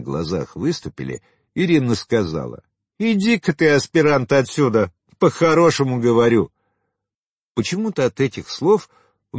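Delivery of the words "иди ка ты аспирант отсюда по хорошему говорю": high, forceful woman's brush-off; sharp warning to a pushy suitor; scornful dismissal. A narrator's reading. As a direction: send him packing, loud and high. Woman dismisses suitor after laughing.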